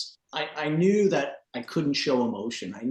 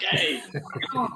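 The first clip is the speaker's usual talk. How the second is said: calling off dog; 7 semitones higher than usual. Retold or imitated.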